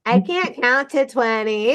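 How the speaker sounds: put-on small child voice. Kid answering the general.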